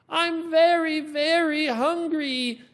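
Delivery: put-on high, loud childlike whine, slow; child character voice. Hungry child in the story, high loud whine. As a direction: plead like a starving kid. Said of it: "different sounds in the character".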